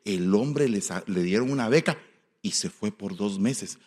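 usual speaking voice

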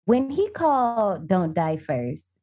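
normal talking voice

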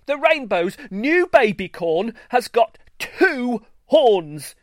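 high, loud insistence; insistent. A narrator's reading. Greenstar insisting to the crowd, insistent. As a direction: press the point hard. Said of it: "Greenstar insisted".

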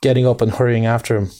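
ordinary delivery

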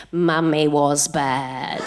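put-on slow, low, droning child's singsong accusation; a two-year-old tattling from the back seat; imitating a toddler. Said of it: "hear from the back seat".

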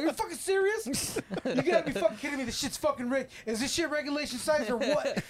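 hammed-up gamer rage, cursing at the machine; retold yelling at the game. Retelling how he yelled at the game while his girlfriend watched, mock rage. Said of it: "I'm just yelling shit out".

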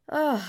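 drawn-out groan of frustration; exasperated groan. Real, not acted. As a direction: let out a fed-up "ugh". Exasperated at end of rant.

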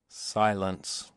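usual speaking voice

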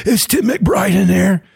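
high, slow cop yell through the house; retold yell. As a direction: call out like a cop searching the house. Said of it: "I hear this guy yelling".

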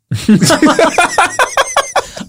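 high-pitched giggle burst. Real, not acted. Laughs at own answer.

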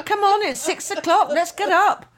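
high, loud, giddy wake-up call; a silly gran rousing sleepy kids at dawn; Mad Nana. Retold or imitated. Gran waking the grandkids early, high and loud. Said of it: "There's Mad Nana".

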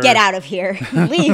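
loud, playful dismissal with a laugh; mock banishment. Throwing co-host out over a joke, mock banishment. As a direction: order them out loudly, half laughing.

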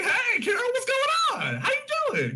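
very high-pitched mock-friendly hello; oblivious cheery greeting. [name] greets Carol, clueless about what's going on, in a high cheery voice. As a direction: squeal a greeting, hammed up.

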